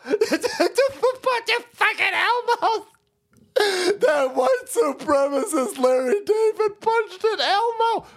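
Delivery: high-pitched whining mock outrage; mock crybaby. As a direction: whine it like a hurt crybaby. Mocking a crying Elmo fan.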